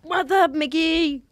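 high, loud, drawn-out "wassup"; playful catchphrase greeting. Pretend. Greeting co-host Mickey, high drawn-out catchphrase. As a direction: call it out long and silly.

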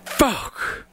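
high, drawn-out mock desperation; desperate friend. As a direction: imitate a buddy in despair. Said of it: "the disparity in his voice".

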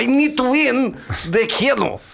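high slow mocking Putin impression. Cohost mocks Putin wanting to win Keno, high slow put-on voice. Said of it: "Han bara".

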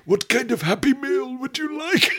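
high, strained film-character impression; hammed-up dramatic movie character; Saw Gerrera. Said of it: "Saw Gerrera is the guy who I'm doing the impression of".

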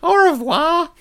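very high squeaky sign-off; Dunstan's shrill voice. Retold or imitated. Dunstan signs off with his catchphrase, very high and squeaky. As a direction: chirp a cheery goodbye. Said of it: "that's what he'd often say".

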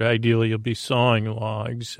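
normal talking voice

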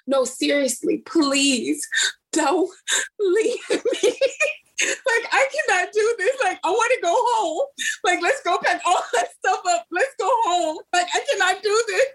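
high, whiny tearful pleading; mock bawling. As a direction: beg like a kid not to be left. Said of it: "bawling my eyes out".

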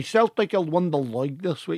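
put-on Scottish voice; attempted Scottish accent. Shown as a demonstration. Proving the accent to doubting friends, put-on Scottish accent. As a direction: have another try at broader Scottish. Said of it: "that's not Scottish enough".

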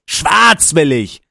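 slow, loud demanding yell; angry shout. Retold or imitated. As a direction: bellow it at the printer. Yelling at printer.

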